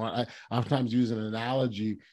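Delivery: usual speaking voice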